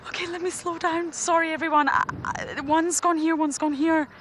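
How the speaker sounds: high, loud and stumbling with excitement; flustered excitement. Real, not acted. Guide telling viewers which way the cheetahs went, flustered. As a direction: try to regain control while still thrilled.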